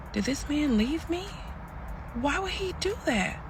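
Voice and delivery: high, soft, bewildered question; hurt disbelief. Retold or imitated. Woman abandoned outside court, high soft disbelief. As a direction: think aloud in a high, small voice.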